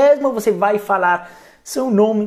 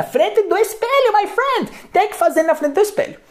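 bem agudo e animado; ênfase animada; 12 semitones higher than usual. Real, not acted. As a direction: insiste empolgado. Insiste animado no espelho. (na frente do espelho, empolgado)

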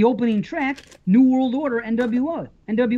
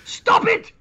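high-pitched mock yell; imitated yell; 12 semitones higher than usual. Host quotes the accused man's outburst, imitated high yell. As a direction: cry it out high in mock outrage. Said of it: "It's that guy yelling".

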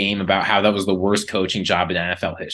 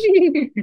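high squealing horse neigh; whinny like a horse. Pretend. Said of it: "That was a horse".

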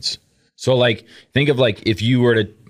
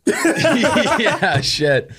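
laughing; 13 semitones higher than usual. Real, not acted. Laughing after teasing.

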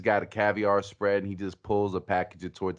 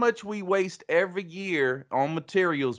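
hammed-up Southern pitchman; raised, mock-earnest Southern pitch; Tex Pablo; 7 semitones higher than usual. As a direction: preach it like a twangy salesman. Tex Pablo's mock sales rant.

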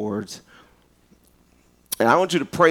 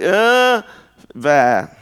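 disgusted; 5 semitones higher, 4 dB louder than usual. Shown as a demonstration. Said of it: "make a face like it stinks".

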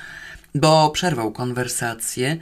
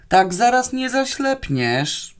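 higher, slow, uncertain comeback; unsure retort; 9 semitones higher, slower than usual. Acted. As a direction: call back with little conviction. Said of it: "zabrzmiało to zupełnie niepewnie". (niepewna odpowiedź, wyżej i wolno)

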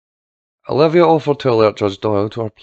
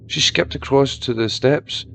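ordinary delivery

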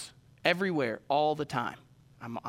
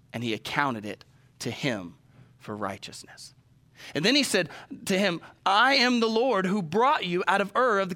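scripture reading; 2 semitones higher than usual. Acted. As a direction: read it out like holy writ, deliberately. Reads scripture aloud.

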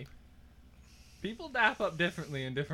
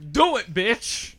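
loud taunt; 2 semitones higher, 7 dB louder, slower than usual. Pretend. Drunk banter taunt.

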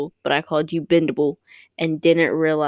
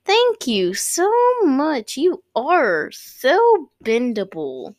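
gushing thanks; 7 semitones higher, much slower than usual. Retold or imitated.

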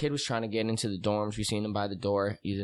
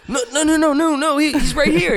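much higher and louder, frantic; panicked denial; 10 dB louder than usual. Retold or imitated. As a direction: desperately shift the blame. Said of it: "He goes".

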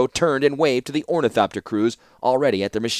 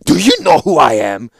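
put-on loud, higher, affronted young ruler; indignant demand; 5 semitones higher, 9 dB louder than usual. A narrator's reading. Young ruler challenging the warlord who talked down to him, demanding. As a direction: demand it with wounded authority. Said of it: "demanded the young Falaji".

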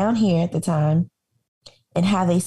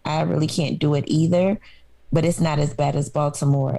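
ordinary talk

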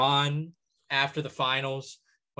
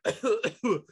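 short mock cough; imitated coughing; 9 semitones higher than usual. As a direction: act out a smoker's coughing fit. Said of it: "And he's coughing, he's going like".

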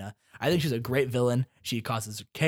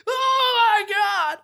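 overexcited exclamation; 7 dB louder, much slower than usual. Pretend. Excited mock host reveal.

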